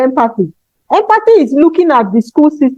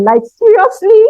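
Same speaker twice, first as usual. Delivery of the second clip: incredulous; 8 semitones higher than usual. Real, not acted. Mocking teachers who question emotions.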